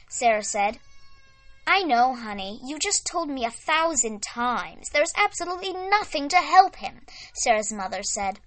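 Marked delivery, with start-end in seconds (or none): 1.7-7.1 exasperated. Acted. Mother exasperated reply.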